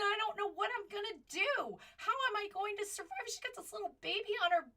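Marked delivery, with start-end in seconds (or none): 0.0-3.2 higher-pitched, mildly distressed retelling of a mother; imitating tearful distress. Tearful young mom at the Capitol asking for help, retold in mild distress. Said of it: "And she's in tears. She said".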